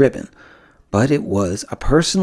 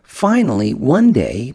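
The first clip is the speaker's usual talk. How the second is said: plain reading voice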